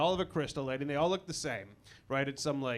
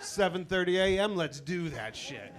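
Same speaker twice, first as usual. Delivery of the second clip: fake excitement; 3 semitones higher than usual. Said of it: "you wanna sound excited".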